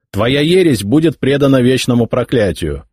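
lightly voiced scornful heir; arrogant young emperor. A narrator's reading. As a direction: order the old bishop away with disdain. Nephew voiced, mocking mercy.

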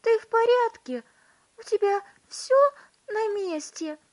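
very high, loud frantic questions; anxious mother shouting. A narrator's reading. Mother checking her tiny son, shouting anxiously. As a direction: call out in fretful alarm. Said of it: "закричала миссис Тиви".

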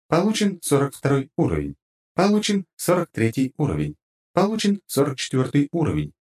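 high, even notification read; system voice. Acted. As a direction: read stats like a game interface. Game level-up readout. (голос игровой системы)

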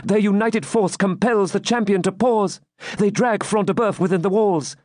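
high-pitched urgent narration of battle; agitated maiden. Acted. Maiden watches rescue of fallen giant, urgent. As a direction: report each move high and excitedly.

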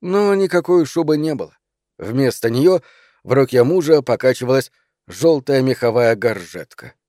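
ordinary delivery

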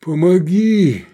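loud, drawn-out desperate plea; anguished high cry for help; pleading. A narrator's reading. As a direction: beg for help with all your might. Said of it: "взмолился Сергей".

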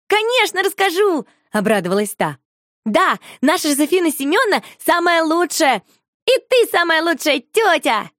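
put-on high, loud, eager little girl; excited child voice. A narrator's reading. Shusha gushes to the visiting lady, excited and high. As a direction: gush happily like a small child.

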